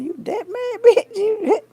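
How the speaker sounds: high, loud laughing squeal; laughing hard. Real, not acted. Mocking angry haters while laughing loudly. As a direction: cackle at how mad they are.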